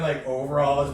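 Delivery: ordinary talk, nothing marked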